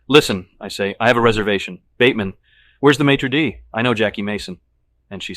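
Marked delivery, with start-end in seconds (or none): 0.1-0.5 loud, sharp call for attention; loud demand. A narrator's reading. Demanding a table loudly. 1.0-4.6 loud, sharp call for attention; loud demand. A narrator's reading. Demanding a table loudly.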